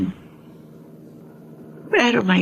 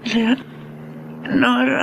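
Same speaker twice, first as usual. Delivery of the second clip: very slow, much softer, barely getting the name out; faint, fading voice; 3 semitones higher, 4 dB quieter, much slower than usual. Acted. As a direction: fade out as if about to faint. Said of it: "bakit ganyan ang boses mo".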